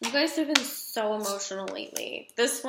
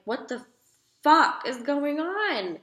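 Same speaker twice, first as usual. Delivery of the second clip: higher, louder, slowed disbelief; mock exasperated; 5 semitones higher, 4 dB louder than usual. Reader voicing a fed-up Cancer, mock exasperation. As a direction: play the fed-up Cancer asking what is happening. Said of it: "it's like".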